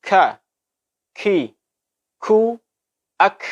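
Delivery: ka ki ku ak with too much air; overdone aspiration. Shown as a demonstration. Teacher showing the wrong breathy kaf. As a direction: puff out each syllable. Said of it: "jangan kita ucapkan seperti ini".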